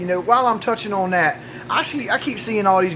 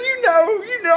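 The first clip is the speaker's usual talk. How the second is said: high-pitched mock pleading; distressed wife. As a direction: imitate an upset wife, pitched way up. Wife hints at missing dentures.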